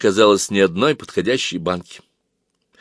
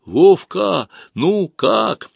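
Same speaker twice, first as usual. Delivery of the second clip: calling out; 9 semitones higher, 4 dB louder, much slower than usual. Acted. Calling down the periscope.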